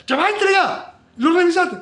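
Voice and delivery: a fed-up professor rushing a student to hand in the exam; impatient teacher. Retold or imitated. Preacher voicing his angry teacher demanding the exam. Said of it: "la profesora me ponía bravo porque decía".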